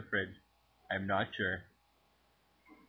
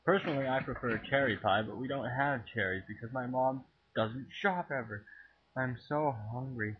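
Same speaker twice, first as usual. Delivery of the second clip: ordinary delivery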